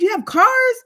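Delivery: high, incredulous question; acted astonishment. Retelling her question to African friend, mock astonishment. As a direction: squeak it in mock disbelief.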